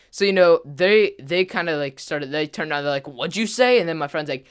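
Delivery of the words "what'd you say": louder, higher confrontational question; imitated challenge. Rival turns round on the heckler, imitated challenge. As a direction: imitate a rival demanding a repeat.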